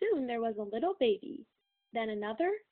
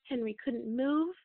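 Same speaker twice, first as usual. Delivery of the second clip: plain reading voice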